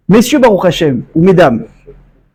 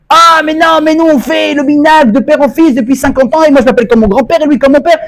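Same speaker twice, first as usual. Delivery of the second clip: mock proud parent; 5 semitones higher than usual. Imitating a proud traditional parent.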